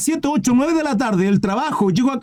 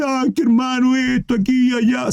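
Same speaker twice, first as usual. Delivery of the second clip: self-pitying whine; 4 semitones higher than usual. Retold or imitated. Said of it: "no está autolamentándose".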